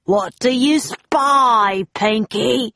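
slow, loud, high-rising captain's call; pirate shout. A narrator's reading. Captain Bones calls up to Pinky, shouting. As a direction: bellow it slowly up to a lookout. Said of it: "shouted Captain Bones".

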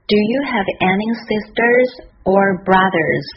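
slow demonstration, "sisters" up, "brothers" down; model reading. Shown as a demonstration. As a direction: read the whole question slowly and clearly for learners. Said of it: "多读两遍吧".

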